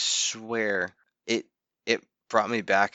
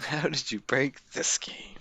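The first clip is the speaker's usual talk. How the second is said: whispering; 4 semitones higher than usual. Real, not acted. Echoing question under breath.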